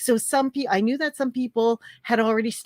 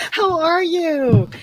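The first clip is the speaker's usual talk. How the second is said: excited greeting; 10 semitones higher, 8 dB louder, much slower than usual. Real, not acted. Opening greeting on a livestream.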